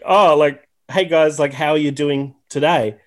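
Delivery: ordinary talk, nothing marked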